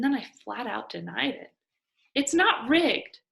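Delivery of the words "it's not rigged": high, loud and slow insistence; firm denial. Acted. Girl flatly denying the contest is rigged, loud and slow. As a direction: dig in and deny it hard. Mia firmly denying the rumor.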